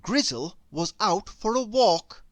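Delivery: plain reading voice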